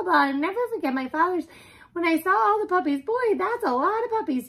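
high, loud, fast nonstop prattle; chatty talking dog. Acted. Dog tells the early days of her life, babbling. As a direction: rattle on without a breath as the dog. Martha's life story goes on.

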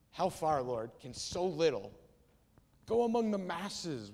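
slow, heavily stressed sarcastic question; absolute sarcasm. Acted. Andrew doubts Jesus over the small meal, absolute sarcasm. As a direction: drag it out with pointed sarcasm, punching the key words. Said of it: "He says it in absolute sarcasm".

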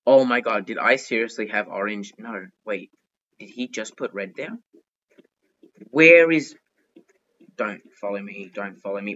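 ordinary talk

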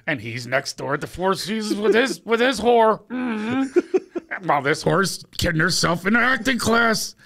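high, loud catty mockery with a laugh; snide teacher aside. Teacher mocking the director's wife to Craig, high catty sneer. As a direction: sneer like a gossiping coach. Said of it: "teacher's aside about student".

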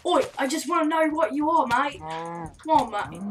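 loud, cheeky put-on "mate" voice; a hint of a jokey Aussie accent; mock Aussie bloke. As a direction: hail it like a pub mate. Calling out to a mob.

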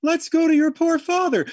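high, loud frantic cry to wake the house; hysterical shout. A narrator's reading. Mother shouting in alarm about father, high frantic shout. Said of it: "They exchanged shout for shout".

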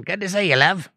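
a hammed-up Australian greeting to a viewer; Australian accent. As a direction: toss it off like a cheery Australian. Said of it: "everybody's Australian all of a sudden".

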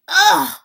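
high, loud yelp of disgust; disgusted scream. A narrator's reading. Guest bites into chocolate-covered fish, screaming. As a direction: blurt a revolted cry. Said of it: "she screamed".

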